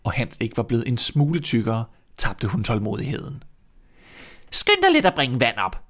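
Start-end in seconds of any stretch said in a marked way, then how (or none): 4.5-5.8 put-on raised, commanding old witch voice; bossy witch voice. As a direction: order the girl about like a witch. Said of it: "sagde hun til Grete".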